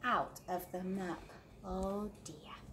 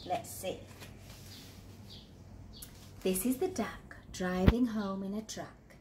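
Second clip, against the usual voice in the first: plain reading voice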